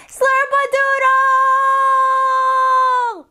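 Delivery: long, high yell with a drawn-out ending; full-volume outdoor shout. Shown as a demonstration. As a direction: holler it as loud as you can, to carry far. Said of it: "as loud as you can".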